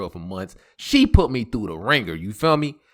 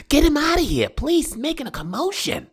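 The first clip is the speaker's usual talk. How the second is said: mock nail lady. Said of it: "she was like".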